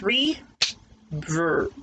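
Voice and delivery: "river" stretched into two slow clapped beats, "ri" and "ver"; clapping syllables for grade three. Shown as a demonstration. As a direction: draw "river" out slowly, one clap per beat. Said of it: "Now let's have some beat".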